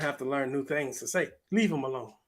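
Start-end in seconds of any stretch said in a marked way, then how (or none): none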